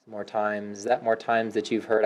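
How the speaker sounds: normal talking voice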